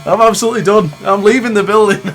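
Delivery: loud, high, laughing between the words; exasperated laughing give-up. Real, not acted. Racer to viewers as the rival cheats again, loud exasperated laughing. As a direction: throw up your hands and laugh it off loudly.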